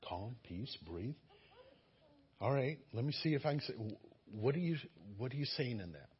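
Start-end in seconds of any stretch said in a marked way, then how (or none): 0.1-1.2 softer, lower, breathy calm; calming himself. Shown as a demonstration. Preacher calming himself down mid-sermon, hushed. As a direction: settle yourself down, slowly. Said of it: "self-calming before continuing".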